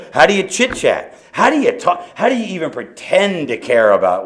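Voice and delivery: high, loud exasperation; exasperated. Retold or imitated. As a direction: throw up your hands. Exasperated rhetorical questions.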